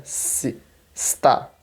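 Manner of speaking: "Si, sta" with a long hissed S; plain S. Shown as a demonstration. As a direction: isolate the clean SS, then land on T in "sta". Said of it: "O S não vai ser".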